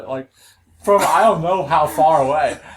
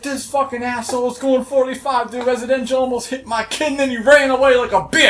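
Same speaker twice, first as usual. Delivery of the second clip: high-pitched ranting, building louder; imitated angry screaming; 9 semitones higher, 4 dB louder than usual. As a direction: imitate a furious father's loud accusation. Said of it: "fucking screaming at the top of his voice".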